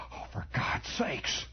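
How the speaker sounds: slow, soft, breath-only exclamation; quiet, drawn-out amazement; hushed disbelief. Acted. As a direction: murmur it in stunned awe. Awed fisherman looking at the fish.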